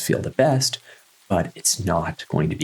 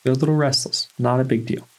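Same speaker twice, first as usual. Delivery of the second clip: higher-pitched, no-fuss reassurance; accepting self-talk; 7 semitones higher than usual. Pretend. Guide modelling what to say about restlessness, accepting self-talk. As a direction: shrug it off in a high self-talk voice. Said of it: "Just say".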